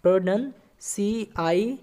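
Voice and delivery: very slow letters with long pauses; spelling out slowly. Shown as a demonstration. Teacher spelling a long word for students, very slow. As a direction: dictate each letter so they can follow. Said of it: "pronunciation को कैसे लिखिएगा आप लोग?".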